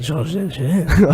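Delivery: slow, raspy French chef accent. Shown as a demonstration. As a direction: purr the name like a French chef. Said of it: "that was well French".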